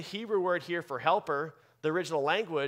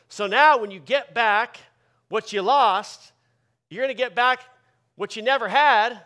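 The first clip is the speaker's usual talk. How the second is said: preaching at full force; 6 semitones higher, 9 dB louder than usual. Acted. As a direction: build to a rousing pulpit peak. Preaching climax, loud and high.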